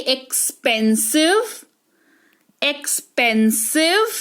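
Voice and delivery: slow, syllable-by-syllable एक्सपेंसिव with ks after the first vowel. Shown as a demonstration. Teacher showing X's sound, slow एक्सपेंसिव with a clear ks. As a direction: sound out एक्सपेंसिव slowly, half k into s. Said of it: "एक्स का उच्चारण आधा क और स".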